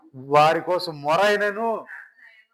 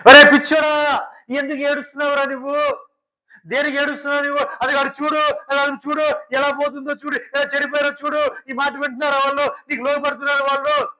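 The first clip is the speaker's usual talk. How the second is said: a loud call, then a raised rebuke; chiding God; 9 semitones higher, 5 dB louder than usual. Acted. God chides his crying servant, rebuking. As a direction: scold him for weeping over them.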